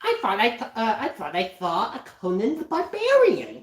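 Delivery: high, playful cartoon parody; cartoon catchphrase voice. Mock-spotting Conan the Barbarian, high cartoon voice. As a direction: raise the pitch like a little cartoon character.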